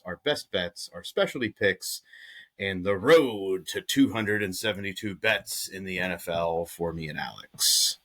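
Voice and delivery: ordinary talk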